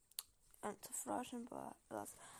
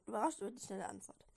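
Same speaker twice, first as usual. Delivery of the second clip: ordinary delivery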